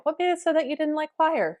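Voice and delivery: high, open questioning tone; non-judging curiosity. Retold or imitated. Teacher probing why student disliked choir, open curiosity. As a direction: raise the pitch and ask innocently. Teacher retells careful question, eyebrows not angry.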